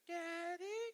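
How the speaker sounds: put-on high little-girl call; little daughter's voice. Youngest daughter calling dad from her bed, high girlish voice. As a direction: call up sweetly like a small girl. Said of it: "I hear her go".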